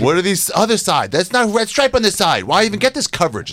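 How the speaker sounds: high, indignant mock complaint; mock fussy star. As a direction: fuss like a spoiled star about camera angles. Mocking a vain comic's demands.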